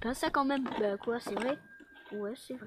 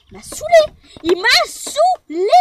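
it bursts out much higher and louder; high loud outburst; 11 semitones higher, 7 dB louder than usual. Acted. Housemate shrieking her complaint about the guy, high and loud. Repeats complaint several ways.

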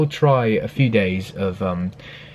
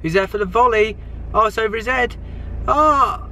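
rising excited play-by-play; excited commentary; 12 semitones higher than usual. Real, not acted. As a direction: call the action as it happens. Live commentary on kids' match.

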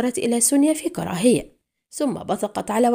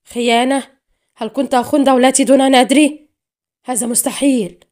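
loud, raised cry of disbelief; outburst shout; 3 semitones higher, 8 dB louder than usual. A narrator's reading. Man realising he betrayed his country, shouting. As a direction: explode in horrified protest. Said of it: "ثم انفجر صارخا".

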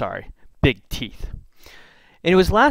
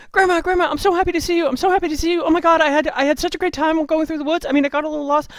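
put-on much higher, gushing girlish voice; rambling little girl; 4 dB louder, faster than usual. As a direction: bubble over like an overjoyed little girl. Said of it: "She's rambling away".

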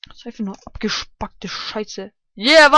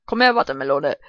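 ordinary talk, nothing marked

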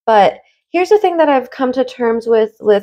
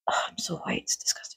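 near-silent self-deprecating whisper; whispered aside; 16 dB quieter than usual. Real, not acted. Embarrassed at own pronunciation, whispered aside.

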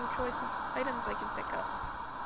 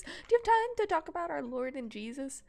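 louder, higher bright preacher pitch; door-to-door preacher; 4 semitones higher, 6 dB louder than usual. Shown as a demonstration. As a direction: pitch it like an eager missionary. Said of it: "Wait, that didn't sound right".

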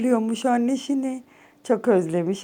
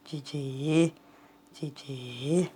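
much softer, lower cooing; 9 semitones lower, 10 dB quieter, much slower than usual. Real, not acted. Owner softly cooing cici to calm her cat. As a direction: murmur it gently, almost gone.

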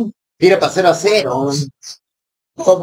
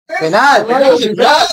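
excited shouting; 7 semitones higher, 4 dB louder, slower than usual. Real, not acted. Still celebrating the penalty.

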